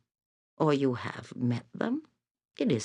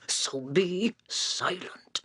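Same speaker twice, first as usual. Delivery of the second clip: slow, higher, imperious order; commanding; 6 semitones higher than usual. Acted. Seer orders the hero to stop talking, slow and commanding. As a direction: hush him like a queen.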